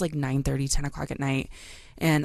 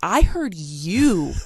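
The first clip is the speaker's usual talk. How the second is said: slow, deliberate, heavy insistence; wife firmly insisting to her husband; 3 semitones higher, 5 dB louder, much slower than usual. Retold or imitated. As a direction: lean on every word, drawn out. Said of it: "And I said".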